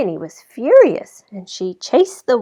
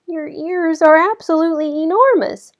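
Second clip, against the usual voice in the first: put-on amazed little girl; 9 semitones higher, 8 dB louder than usual. A narrator's reading.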